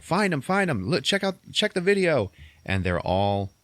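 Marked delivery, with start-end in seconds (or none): 0.0-2.3 high, hurried boss barking orders; bossy order. Retold or imitated. Boss ordering the chubby girl, hurried and high. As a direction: play the frantic boss pushing his staff. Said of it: "the boss was like".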